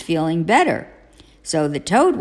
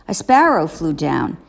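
usual speaking voice

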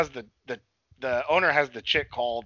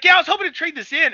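higher, louder and quicker, a nerdy customer; loud nerd voice; 7 dB louder, faster than usual. Retold or imitated. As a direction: chirp it loudly like an eager nerd. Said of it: "that dude that was talking loud".

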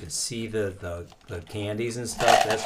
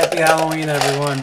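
loud, higher-pitched holiday shout; festive call-out; 6 semitones higher, 8 dB louder than usual. Pretend. Host to everyone as candy pours out, festive call-out. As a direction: call it out to the whole room. Calls out greeting while pouring candy.

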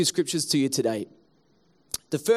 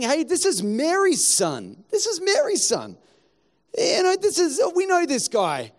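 higher, sneering villagers scoffing at Jesus; scornful townsfolk; 5 semitones higher than usual. Acted. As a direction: scoff like gossiping neighbours. Mocking townspeople dismiss Jesus.

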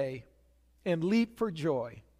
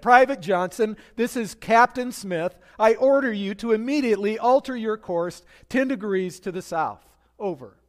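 louder radio command; shouting captain; 4 semitones higher, 7 dB louder than usual. Retold or imitated. Captain shouting an order at the private, louder. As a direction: bark the order over the radio. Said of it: "he shouted back on the radio".

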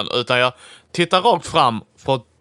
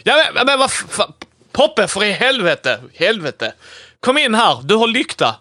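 higher, swearing, urgent call; agitated calling out; 7 semitones higher than usual. Acted. Player frantically calls Poppe in with the lamp, agitated. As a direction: call your mate over urgently.

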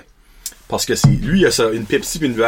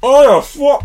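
high, loud startled shout; 11 semitones higher, 6 dB louder, slower than usual. Real, not acted. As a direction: yelp as the heat hits. Reacting to spicy bite.

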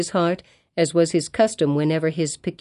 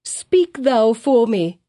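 slow, higher and louder plea; pleading cry; 5 semitones higher, 3 dB louder, slower than usual. A narrator's reading. Hester begging the young clergyman, slow loud plea. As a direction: cry out for help. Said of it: "cried she".

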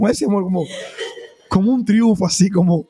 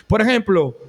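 ordinary talk, nothing marked

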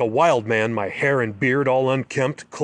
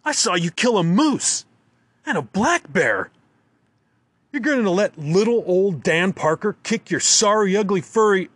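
angry taunt; 6 semitones higher than usual. A narrator's reading. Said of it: "I holler".

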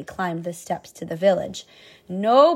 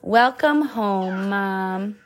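tender, slow; much slower than usual. Acted. Tom greets his mother.

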